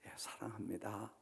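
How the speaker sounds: unvoiced, humble whisper; whispered answer. Peter answering Jesus again, in a whisper. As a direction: breathe the answer out, ashamed. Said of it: "네가 날 정말 사랑하느냐?".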